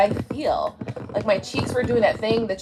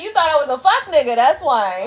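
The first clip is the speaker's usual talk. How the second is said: faster, louder with a laugh; louder, laughing repeat; 4 semitones higher, 5 dB louder than usual. Real, not acted. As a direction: say it again louder, still laughing. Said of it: "I said".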